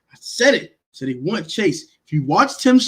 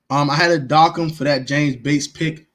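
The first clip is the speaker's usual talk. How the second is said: ordinary talk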